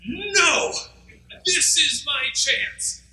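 high, defiant, and slow on this is my chance; defiant insistence. Acted. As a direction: insist stubbornly over the crowd. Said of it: "And he says".

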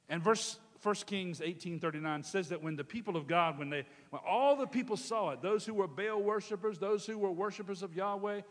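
preacher building to the climax; preaching. Acted. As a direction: drive the scripture home.

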